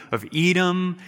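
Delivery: ordinary delivery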